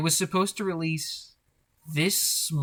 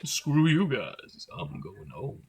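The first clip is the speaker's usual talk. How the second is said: deep rich voice; slower than usual. Retold or imitated. Answering a friend's challenge with a low crooner voice. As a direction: croon it slowly, going low at the end. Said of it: "sends a chill up your spine".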